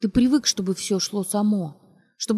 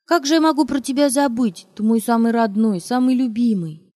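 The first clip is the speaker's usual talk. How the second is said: louder, higher cheerful reassurance; 4 semitones higher, 6 dB louder than usual. Acted. Mother reassuring son, louder cheerful gushing. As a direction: gush affection at him. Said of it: "тем же весёлым голосом".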